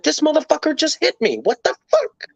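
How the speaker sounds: outraged disbelief. Retold or imitated. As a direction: build from shock to a squeaky what the fuck. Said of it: "That was my thought process".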